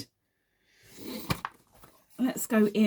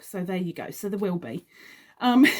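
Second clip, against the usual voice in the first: ordinary delivery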